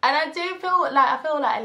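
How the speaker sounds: worked-up retelling of the labour argument; heated outburst. Retold or imitated. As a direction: push it up with rising heat.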